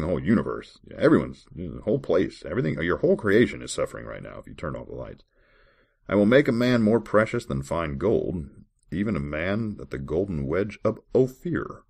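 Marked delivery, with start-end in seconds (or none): 6.1-11.8 measured, formal Bible reading voice; scripture reading. Acted. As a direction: read the verse like a solemn lesson. Reads Bible verses aloud.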